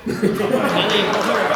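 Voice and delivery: slow, laughing through the words. Real, not acted. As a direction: laugh while asking. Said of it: "laughing mid-question".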